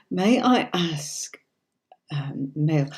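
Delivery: slow whisper off to the side; hushed question to Mel; whispered aside. Real, not acted. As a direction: whisper so viewers barely hear.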